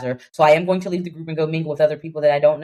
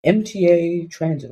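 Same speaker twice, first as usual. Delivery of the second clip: subway announcement voice. Retold or imitated. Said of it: "whatever the hell that thing says at the end".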